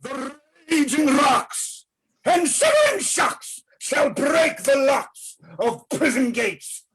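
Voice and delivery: booming ranting tyrant verse; Hercules' vein. Acted. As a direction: rant it like a stage tyrant. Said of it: "this is Hercules' vein".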